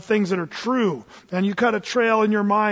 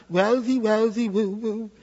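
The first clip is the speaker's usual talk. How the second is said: mock depressed; slower than usual. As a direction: moan in exaggerated self-pity. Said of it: "he's totally depressed".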